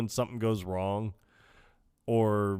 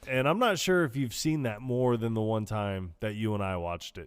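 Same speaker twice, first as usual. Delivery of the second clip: ordinary talk, nothing marked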